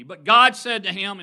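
But God said to him, with God hit loud; emphasis on God. Shown as a demonstration. Preacher stressing God to the congregation. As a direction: lean hard on God. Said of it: "and put emphasis on that".

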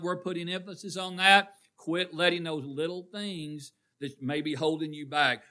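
ordinary talk, nothing marked

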